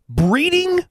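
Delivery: high, angry disbelief; indignant growl. A narrator's reading. David takes offence at the slur on his upbringing, angry echo. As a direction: throw the word back, pitched high and affronted. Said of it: "David growled".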